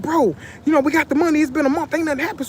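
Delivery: mock the homeboy's insistent, high-pitched arguing. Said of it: "he legit argued me down".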